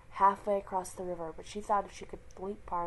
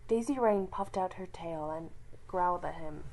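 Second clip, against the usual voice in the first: ordinary delivery, nothing marked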